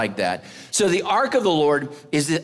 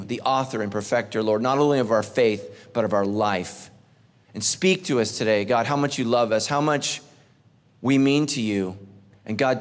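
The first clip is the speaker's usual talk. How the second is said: prayer; 4 semitones lower than usual. Acted. Pastor leads opening prayer.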